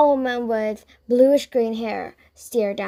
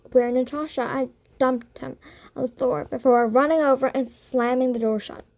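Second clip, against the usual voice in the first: usual speaking voice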